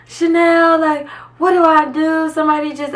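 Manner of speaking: high, panicked crying plea; a touch of retold tearful panic; crying on the phone.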